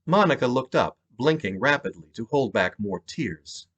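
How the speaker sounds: ordinary delivery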